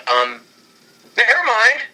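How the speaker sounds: ordinary talk, nothing marked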